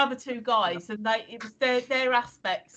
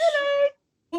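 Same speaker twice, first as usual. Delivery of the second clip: put-on baby talk; 4 dB louder than usual. Greeting the dog.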